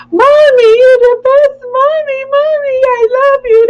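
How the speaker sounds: put-on high, loud childlike gushing; imitating a small daughter adoring mommy; little girl voice. Mother imitating her little daughter gushing, high childlike voice.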